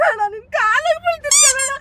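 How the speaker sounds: loud, high panicked begging; frantic pleading. Real, not acted. Captive begs kidnapper not to use the needle, frantic pleading. Victim begs at his feet.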